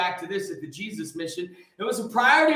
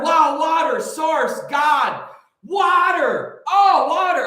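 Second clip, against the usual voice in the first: higher, louder ecstatic praise; half-joking ecstasy over water; mock rapture; 6 semitones higher, 7 dB louder, slower than usual. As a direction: gush over water like a revelation. Rhapsodizing about water.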